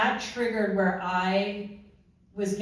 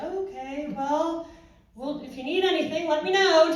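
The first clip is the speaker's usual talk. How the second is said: high mock-helpful voice, growing louder; awkward backing off; 9 semitones higher, 6 dB louder than usual. Supervisor not wanting to ask, awkward. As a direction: back away with a pitched-up offer.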